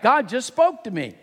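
put-on high-pitched child's announcement; little daughter. Daughter stalling dad at bedtime, high insistent child voice. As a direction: pipe up like an insistent little girl. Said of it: "she yelled back".

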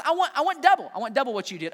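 "double" punched high and loud; stress on double. Retold or imitated. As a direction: lean hard on "double". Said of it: "Elisha says".

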